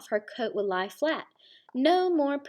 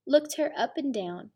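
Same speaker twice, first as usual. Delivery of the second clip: ordinary delivery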